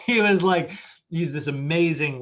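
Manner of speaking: usual speaking voice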